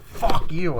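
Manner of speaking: loud, high mock fury; angry retort. Girls fighting over the gun, loud angry retort. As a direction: hurl the curse in an acted row. Acted quarrel.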